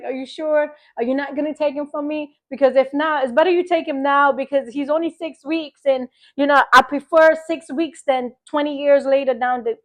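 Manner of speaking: a mother begging God to decide now; anxious pleading. Retold or imitated. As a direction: beg for certainty, rushing. Pleading with God over her son.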